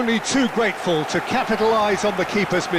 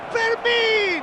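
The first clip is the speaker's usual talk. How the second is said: high excited shouted name. Acted. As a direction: yell the name as he shoots. Commentator calls the shot.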